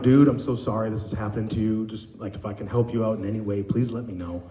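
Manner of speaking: low, soft, gentle reassurance; consoling. Retold or imitated. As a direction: soothe a screaming child at his level. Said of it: "calm him down or console him".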